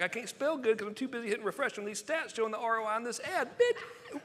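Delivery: high, silly boast ending in a squeal; mocking comeback. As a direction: throw the taunt back in a high mock voice. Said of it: "I'm like".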